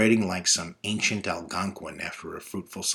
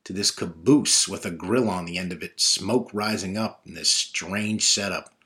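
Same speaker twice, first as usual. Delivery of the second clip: plain reading voice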